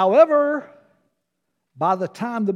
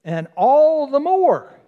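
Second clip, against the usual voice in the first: slow, louder and higher on all the more; stressed phrase; 6 semitones higher, 5 dB louder, slower than usual. Real, not acted. Pastor highlighting the underlined phrase, emphatic. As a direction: underline it with your voice. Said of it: "I underlined it in pen".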